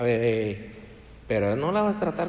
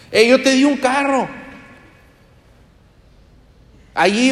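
loud, high reproachful voice; the Lord demanding account; 10 semitones higher, 6 dB louder than usual. Acted. The Lord confronting a selfish car owner, loud and high. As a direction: call the believer to account, booming. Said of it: "un día nos va a pedir cuentas".

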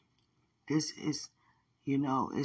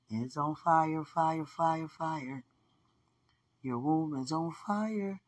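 slow, higher, chanted proclamation of fire; prophetic declaration; 3 semitones higher than usual. Acted. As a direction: declare it like a prophet over them. Prophetic declaration over listeners.

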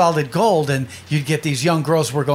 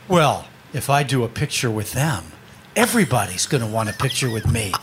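lower-pitched, measured pompous refusal; imitated self-important star; 6 semitones lower, 3 dB quieter than usual. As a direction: mimic a celebrity full of himself, pitched down. Retold self-important celebrity refusing a photo.

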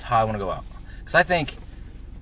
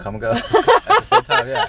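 high giggling laugh through the words; 10 dB louder, slower than usual. Real, not acted. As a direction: laugh it out, much higher. Laughing at sex joke.

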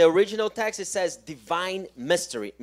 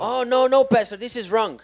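loud, higher mock protest; indignant objection; 5 semitones higher, 6 dB louder than usual. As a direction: act out the worker snapping back at the pastor. Interpreter echoes objection. (protesta indignada imitada, más fuerte)

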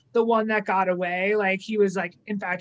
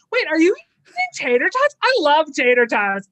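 excited; 6 semitones higher, 6 dB louder than usual. Retold or imitated. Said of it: "she's like".

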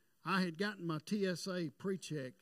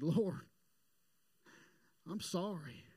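retold tearful prayer; slower than usual. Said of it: "I started weeping and crying".

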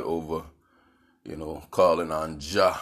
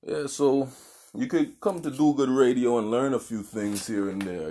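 usual speaking voice

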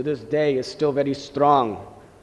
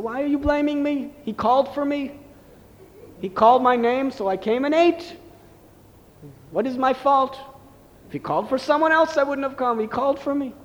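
put-on much higher, playful little boy's voice; a little boy defending himself to the teasing neighbour ladies, high and playful; innocent child; 9 semitones higher than usual. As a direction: protest sweetly like a cheeky little boy. Child Nimai answers the teasing ladies.

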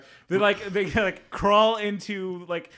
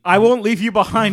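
loud and noble; heroic vow; 4 semitones higher, 8 dB louder than usual. Retold or imitated. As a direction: declare it like a hero. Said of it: "Jake's line quoted".

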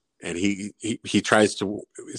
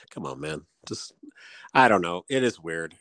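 ordinary talk

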